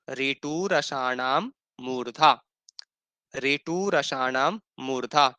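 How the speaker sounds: a teacher's slow chant of the sutra; slow recitation. Shown as a demonstration. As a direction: sound out ऋटु रसाणाम मूर्धा slowly and clearly. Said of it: "इसमें भी एक सूत्र आता है".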